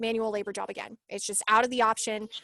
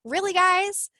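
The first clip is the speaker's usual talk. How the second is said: much higher, louder incredulous retort; exasperated disbelief; 9 semitones higher, 7 dB louder, slower than usual. Retold or imitated. Answering the app's demands, exasperated and incredulous. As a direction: push it up high, fed up.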